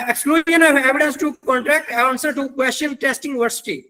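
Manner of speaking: careful, slow reading of a section title; slow statute reading. Acted. Lecturer rereads the corrected section title, slow and careful. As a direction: spell out the legal heading deliberately.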